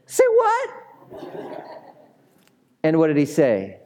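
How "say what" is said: high squeal of disbelief; mock astonishment. Pastor hamming up disbelief at the resurrection. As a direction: act stunned at the news. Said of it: "mock disbelief".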